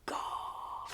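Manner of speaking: unvoiced, soft gasp of a word; hushed amazed murmur. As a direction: murmur in quiet awe. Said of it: "Jemmy murmured".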